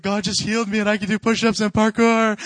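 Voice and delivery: loud, hammed-up tearful testimony; mock crying. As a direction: imitate an overjoyed tearful cry, at full volume. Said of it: "crying. And he's like".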